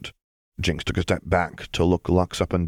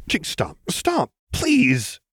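alarmed plea; 10 semitones higher, much slower than usual. Acted. Lux stops Jinx hitting herself.

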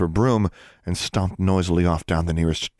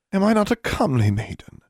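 seductive, slightly impatient; 14 semitones higher, 4 dB louder than usual. A narrator's reading. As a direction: voice lifted high, coaxing with impatience. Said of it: "both seductive and a trifle impatient".

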